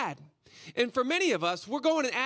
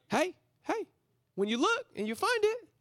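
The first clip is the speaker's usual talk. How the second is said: high, light acted call; high put-on voice; 10 semitones higher than usual. Disciples looking for food from a little kid, high put-on voice. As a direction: pitch it way up, as a playful imitation.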